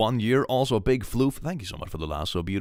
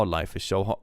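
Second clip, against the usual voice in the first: ordinary delivery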